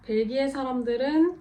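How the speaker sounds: ordinary delivery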